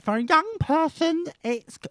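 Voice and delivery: high lisping mimic of the young guest; mocking her voice. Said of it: "this is how she sounds in my head".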